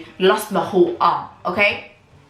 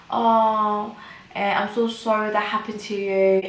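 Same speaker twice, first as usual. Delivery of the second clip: higher, drawn-out cooing "oh"; put-on pitying voice, pitched up; mock-sympathetic midwife; 4 semitones higher, slower than usual. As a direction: imitate a midwife pouring on sympathy.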